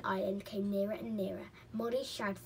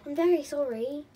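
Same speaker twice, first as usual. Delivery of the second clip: loud, higher-pitched apology; apologetic; 5 semitones higher, 10 dB louder than usual. A narrator's reading. Molly says sorry after the scolding, apologetically. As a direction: apologise as a scolded storybook child.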